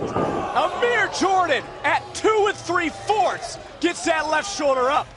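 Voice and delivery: high, slow excited shout; excited call. Real, not acted. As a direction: shout the near fall. Near fall called at two and three fourths.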